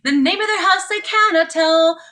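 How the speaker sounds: plain reading voice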